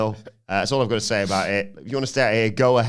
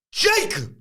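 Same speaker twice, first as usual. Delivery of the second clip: high, loud ring announcer shout; shouted name; 11 semitones higher, 5 dB louder, much slower than usual. Retold or imitated. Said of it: "When I shouted Jacob Fatu".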